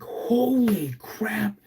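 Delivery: stunned gasp after seeing a face at the window; buddy jumping back in shock; shocked exclamation. Retold or imitated. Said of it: "he jumps back and he's like".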